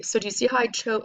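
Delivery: ordinary talk, nothing marked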